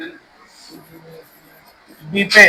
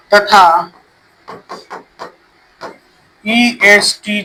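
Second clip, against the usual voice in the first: slow, word by word, for pupils to write down; spaced out, leaving time to write; slow dictation; 2 semitones lower, 3 dB quieter, slower than usual. Shown as a demonstration. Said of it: "यही लिखवाया मैंने".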